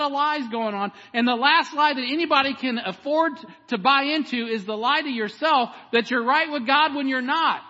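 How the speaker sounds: loud, high, driving sermon delivery; full-force preaching. Real, not acted. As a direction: preach it urgently, pressing the congregation hard.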